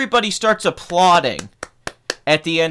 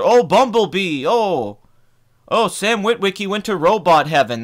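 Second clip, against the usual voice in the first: mocking fans; 3 semitones higher, 3 dB louder than usual. Ranting viewer mocks clapping Transformers fans, sarcastic gushing. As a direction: gush sarcastically over the blockbuster.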